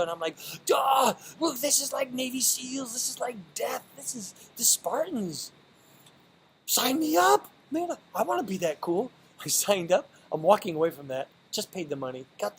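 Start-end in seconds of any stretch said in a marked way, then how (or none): 0.7-9.1 high, loud, thrilled outburst; retold gush of excitement, much higher and louder; excited shout. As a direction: shout it like a kid who just found his dream. Retelling his excited reaction.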